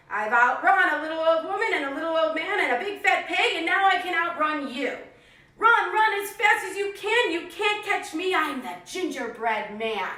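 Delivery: high, loud cheeky boast; taunting gingerbread man. Acted. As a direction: sing it out to tease the cow. Cookie taunts the cow.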